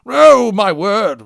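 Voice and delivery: loud, high, slow exclamation; shocked cry. A narrator's reading. Onlooker crying out at the exploding balloon, shocked and loud. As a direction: gasp it out in horror.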